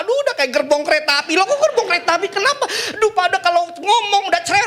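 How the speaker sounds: high, laughing, exasperated husband voice; whiny husband. Acted. A husband complains to the pastor about his wife, high and whiny.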